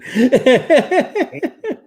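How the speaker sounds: real high laughing fit. Real, not acted. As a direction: burst out laughing at yourself. Laughs at own confusion.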